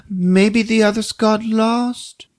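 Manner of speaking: put-on high, slow little boy guess; hopeful kid. Boy guessing where the reindeer went, high slow voice. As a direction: offer it hopefully like a child. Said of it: "Pietri's like".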